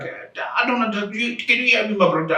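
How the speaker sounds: put-on Indian phone voice, halting at the start; imitated call-centre accent. Call-centre worker asking if he's heard, mock Indian accent. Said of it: "The guy was speaking how".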